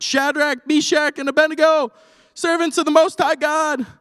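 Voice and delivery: high, loud sitcom-style yell; Jerry Seinfeld yelling. Acted. King calls the three men out of the furnace, high loud yell. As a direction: bellow it up high like a comic king. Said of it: "I don't know why he's yelling, and it sounds like Jerry Seinfeld".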